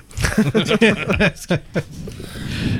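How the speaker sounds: high, drawn-out laugh; long laugh. Real, not acted. Teasing co-host about her Surrey roots, long laugh. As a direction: laugh on after the joke.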